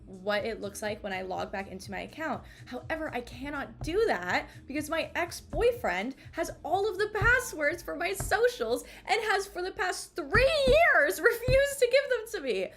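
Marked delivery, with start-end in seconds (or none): 2.7-12.7 building from normal to a high, loud outburst; rising fury. Real, not acted. Venting to viewers about her ex, rising to an angry outburst. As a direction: let the rant climb until it bursts. Ranting about ex-boyfriend's passwords.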